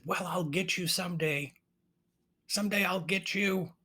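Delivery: high-pitched witch voice; threatening witch. Acted. Witch to raven fleeing with her crown, high mock threat.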